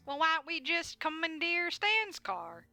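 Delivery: high, slow, loud goofy deputy; deputy proposing to take Stan's car, high and slow; Durland voice. Acted. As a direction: say it like an eager simple deputy.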